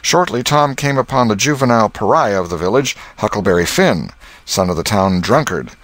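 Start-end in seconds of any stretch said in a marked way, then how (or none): none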